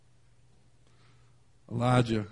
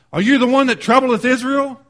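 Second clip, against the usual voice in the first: higher, louder accusing question; accusing challenge; 6 semitones higher, 6 dB louder than usual. Retold or imitated. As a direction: voice a king's loud challenge to a troublemaker. Ahab confronts Elijah in retelling.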